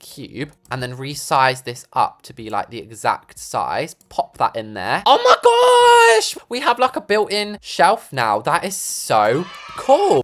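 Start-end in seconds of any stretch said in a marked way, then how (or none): 5.1-8.1 high, loud, drawn-out squeal; excited squeal. Real, not acted. Builder thrilled by the built-in shelf, excited squeal.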